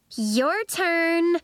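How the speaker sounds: high, taunting singsong. A narrator's reading. Lacey gloats to Nori after her act, singsong. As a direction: sing it out high, held at the end. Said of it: "Lacey sang to Nori".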